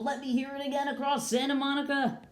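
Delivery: high-pitched rallying call to a distant class; calling across town. Pretend. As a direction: get faraway students to answer back. Calls out to students across town.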